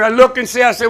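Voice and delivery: usual speaking voice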